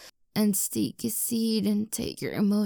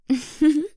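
teasing giggle; 7 semitones higher, 8 dB louder than usual. Acted. Teasing laugh.

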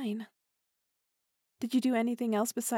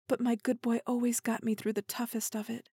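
ordinary talk

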